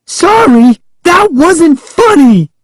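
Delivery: very high, indignant shouted protest; high-pitched shout. A narrator's reading. Pranked kid protests to laughing cousin, high-pitched shout. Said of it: "I managed to shout in a high-pitched voice".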